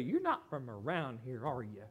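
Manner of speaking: low, quiet knowing question; knowing aside. Acted. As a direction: say it low, sizing up a stranger. Voicing a local spotting an outsider.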